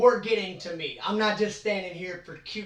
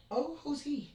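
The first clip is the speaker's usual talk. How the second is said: intrigued; 8 semitones higher, 5 dB quieter than usual. Retold or imitated. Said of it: "And I was like".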